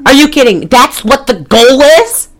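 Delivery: can't believe what the plot wants; incredulous outburst. Real, not acted. Friend ranting about the cat movie, incredulous and pitched high.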